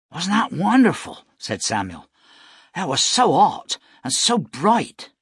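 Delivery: high, loud and amazed; thrilled child. Acted. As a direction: gush with wonder. Boy thrilled by two suns.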